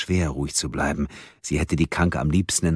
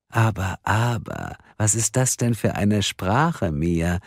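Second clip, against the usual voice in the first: slow, mock-scolding tease; with a wink; 5 semitones higher, slower than usual. Karen mock-scolding furious Mia, winking slow tease. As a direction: chide her playfully, slowly. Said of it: "sagte Karen augenzwinkernd".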